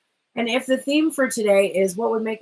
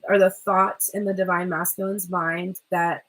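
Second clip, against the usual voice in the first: ordinary talk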